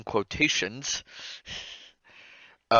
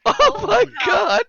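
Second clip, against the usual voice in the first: laughing; 7 dB louder, slower than usual. Real, not acted. Still cracking up.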